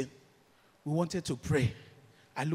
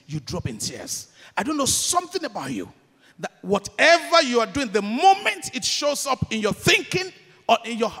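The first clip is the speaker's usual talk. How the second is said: higher and louder, building intensity; rising preaching fervor; 5 semitones higher, 6 dB louder than usual. Real, not acted. Preacher pleading with listeners, building and louder. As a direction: press the point home with rising force.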